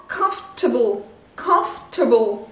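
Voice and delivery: comfortable said the English way, A weak and fort dropped. Shown as a demonstration. Teacher modelling comfortable in English. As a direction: say comfortable as kumf-tuh-bul. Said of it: "le A n'est pas prononcé A".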